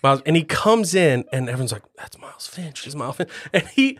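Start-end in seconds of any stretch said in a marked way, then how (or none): 2.0-3.3 whispered star sighting; hushed awe. Acted. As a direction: whisper it to a colleague. Everyone whispers as star writer enters.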